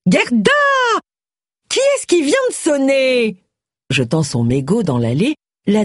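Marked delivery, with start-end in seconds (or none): 0.1-3.4 high, piercing call from inside the house; shrill shout for the maid. A narrator's reading. Missus Toynbee calling the maid from inside, piercing. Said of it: "Une voix perçante retentit".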